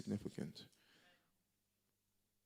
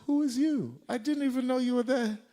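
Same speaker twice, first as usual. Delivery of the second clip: high, loud mocking comeback with a laugh; retorting; 10 dB louder than usual. Preacher retells snapping back at an unfollower, laughing. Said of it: "I said".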